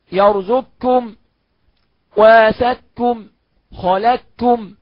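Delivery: slow يرزقكم وسكم, no trace of qaf; full assimilation. Shown as a demonstration. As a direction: drop the ق entirely, doubled ك. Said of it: "أقول". (إدغام كامل ببطء)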